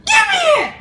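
put-on very high-pitched upset kid voice; high squeaky voice. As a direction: whine it way up high. [name]'s put-on voice.

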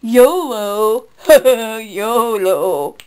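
loud, put-on yolo with fake laughs; sarcastic mimicry with laughter; mocking slang. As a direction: jeer it like a silly kid.

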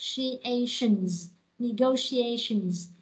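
"Negotiations" slowly, stressed on "go" and "a"; two stresses. Shown as a demonstration. Teacher drills a long word, slow double stress. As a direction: stretch "Negotiations" syllable by syllable, two beats strong. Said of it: "Từ này nó có hai dấu nhấn".

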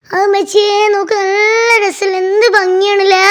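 high, loud gushing delight; excited. Real, not acted. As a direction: squeal with joy at the view. Marvelling at the clear river.

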